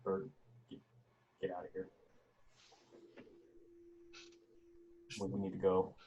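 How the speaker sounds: softly into a radio, urgent; quiet into comms. Pretend. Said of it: "say a lot quieter into my comms".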